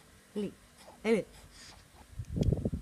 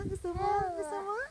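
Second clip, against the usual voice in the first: much higher-pitched coaxing question; 10 semitones higher, faster than usual. Real, not acted. Parent asks the baby if they want it, high baby talk.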